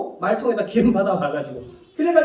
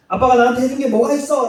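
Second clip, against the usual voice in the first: fast, louder sassy retort; ungrateful child; 5 semitones higher, 5 dB louder, faster than usual. Retold or imitated. Preacher voicing a child sassing dad, fast and louder. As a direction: throw it at dad with a sneer. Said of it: "라고 물을 때".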